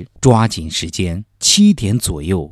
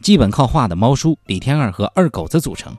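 infomercial; 5 dB louder than usual. Acted. Parody ad, listing experts.

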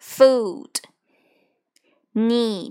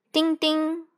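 bicycle bell sound; 6 semitones higher than usual. Shown as a demonstration.

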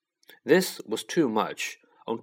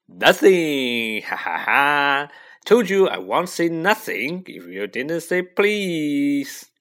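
loud, gloating sing-song with drawn-out ha-ha; gleeful taunting chant; Peeves; 3 semitones higher, 5 dB louder, slower than usual. A narrator's reading. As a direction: crow it slowly in triumph. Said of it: "annoying singing song voice".

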